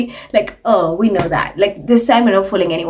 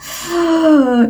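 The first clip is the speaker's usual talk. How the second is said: mock gasp; 6 semitones higher than usual. Mock shock at fashion jab.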